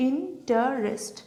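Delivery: "Interest" slowly in three separated parts; syllable by syllable. Shown as a demonstration. Teacher pointing out three syllables, syllable by syllable. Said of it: "তিনটে".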